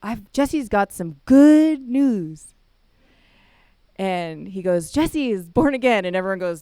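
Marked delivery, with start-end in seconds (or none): 0.0-2.5 high, loud, slow mocking announcement; mock him to the room; sarcastic. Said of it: "in a very sarcastic way". 4.9-6.0 high, loud taunt; mocking. Mocking announcement continues.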